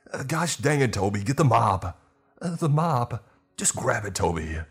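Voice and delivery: high, loud, exasperated orders; frantic shouting. A narrator's reading. Manager under attack yelling at Toby, frantic.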